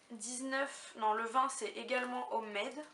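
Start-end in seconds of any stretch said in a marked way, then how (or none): 2.3-2.8 French speaker saying an English word; clumsy English accent on Homemade. Real, not acted. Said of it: "J'ai un accent anglais assez pourri".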